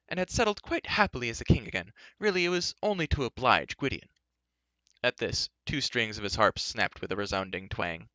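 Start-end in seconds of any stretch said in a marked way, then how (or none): 0.1-4.1 high, swooping theatrical bard; grandly casual storyteller bard; chatty bard, self-important. A narrator's reading. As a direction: explain airily, with flourishes of pitch.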